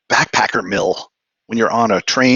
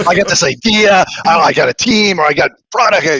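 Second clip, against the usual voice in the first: excited, higher and louder pitch of a naive founder; mock-eager startup pitch; 7 semitones higher, 4 dB louder than usual. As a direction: gush like a founder sure of success.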